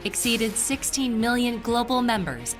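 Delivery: ordinary delivery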